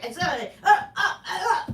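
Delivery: normal talking voice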